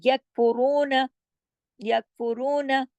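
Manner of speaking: "यकफुरून" slowly with a firm k and heavy r; recitation demo. Shown as a demonstration. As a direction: recite "यकफुरून" slow and measured. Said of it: "वो जमा के पढ़े जाएंगे".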